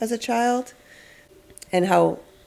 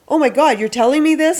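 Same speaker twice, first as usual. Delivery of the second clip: imitating a parent, high and loud with amazement; mock a parent who makes too big a deal; overexcited parent; 7 semitones higher, 6 dB louder than usual. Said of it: "something that parents do sometimes is like".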